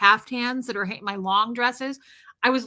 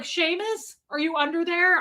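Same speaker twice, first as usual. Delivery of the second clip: high, searching call; calling a pet; 6 semitones higher than usual. Retold or imitated. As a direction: coax a cat out from under furniture. Retells calling to hidden cat.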